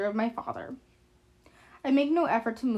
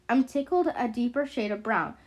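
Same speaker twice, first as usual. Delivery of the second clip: ordinary delivery, nothing marked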